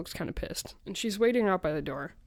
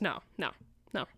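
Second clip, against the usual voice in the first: usual speaking voice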